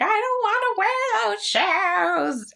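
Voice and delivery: mock child whining; whiny kid. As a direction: whine it at his mom.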